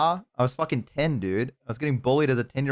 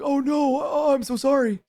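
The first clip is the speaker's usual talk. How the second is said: very high, gushing apology; hammed-up guilty falsetto; mock remorse; slower than usual. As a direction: squeal a stricken apology. Acts out remorse to single mom.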